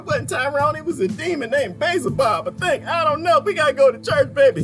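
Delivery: very high, hammed-up wife voice; wife making excuses. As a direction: babble excuses in a mock high-pitched plea. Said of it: "they're trying to convince their husband".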